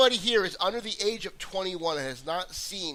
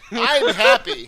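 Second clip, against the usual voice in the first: laughing happy; 10 semitones higher, 14 dB louder, slower than usual. Acted. Mock mood switching bit.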